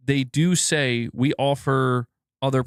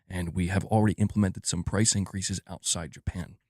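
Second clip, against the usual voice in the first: low-key executive impression, pitched down; Herman Hulst; 4 semitones lower, 3 dB quieter than usual. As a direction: speak low as a reserved corporate executive. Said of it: "I'm gonna let Herman take this one".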